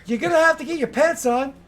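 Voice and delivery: high, loud scolding call; exasperated call. Acted. Woman shouts at Bastian to get dressed. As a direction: yell it through the wall, fed up. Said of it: "and she's like".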